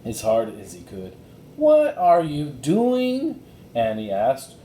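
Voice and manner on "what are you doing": slow, high, louder disbelief; baffled question. A narrator's reading. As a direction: drag it out in disbelief. Annie baffled by Jack.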